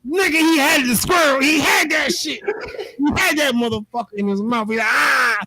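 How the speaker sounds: high, laughing near-yell; laughing excitement. Real, not acted. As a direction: relive the catch, half laughing. Excitedly reliving the dog's catch.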